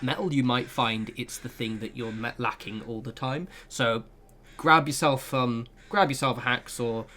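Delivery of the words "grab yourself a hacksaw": ordinary talk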